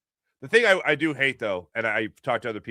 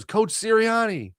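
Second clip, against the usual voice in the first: calling out; 11 semitones higher, 4 dB louder, slower than usual. Retold or imitated. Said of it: "she goes".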